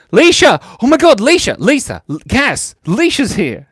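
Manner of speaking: high, loud excited cries; thrilled, high-pitched yell; excited calling out. Real, not acted. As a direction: shout out in delight at a friend arriving. Spots a friend, calls out.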